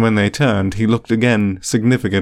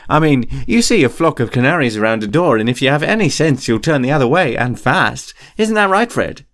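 put-on higher-pitched boyish patter; lively, higher-voiced twin spinning an excuse; glib improvising schoolboy; 4 semitones higher than usual. A narrator's reading. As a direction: pitch the tall tale with easy confidence.

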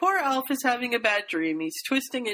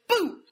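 spooky boo; 11 semitones higher than usual. Retold or imitated. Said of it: "jumps out of the tree and says".

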